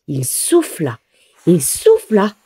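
ordinary delivery

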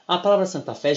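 ordinary talk, nothing marked

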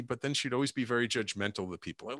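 usual speaking voice

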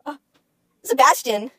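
ordinary talk, nothing marked

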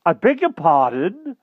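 high, loud, drawn-out apology; exclaiming. A narrator's reading. Trembling king excuses himself before fleeing, exclaiming. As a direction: blurt it out high in a fluster.

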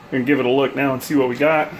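ordinary delivery

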